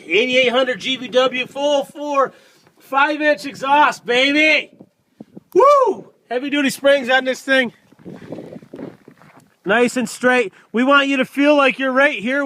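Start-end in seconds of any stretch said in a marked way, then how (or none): 5.5-6.1 high, loud hype whoop; excited whoop. Real, not acted. Salesman hyping the exhaust for viewers, with a high, loud whoop. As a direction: let out a big celebratory woo.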